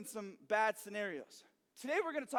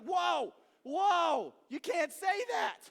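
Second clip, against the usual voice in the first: high, loud, drawn-out whoa; hammed-up scandalized protest; shocked rebuke; 7 semitones higher, 5 dB louder, much slower than usual. Friend hearing an angry insult, loud high shock.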